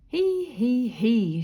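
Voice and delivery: forced, joyless he he he; half-hearted miserable he he he. A narrator's reading. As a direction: squeeze out a sad little giggle. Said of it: "she said miserably".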